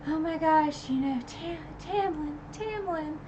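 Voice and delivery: high, soft pleading voice; pleading Feyre. Retold or imitated. Voicing Feyre pleading to Tamlin.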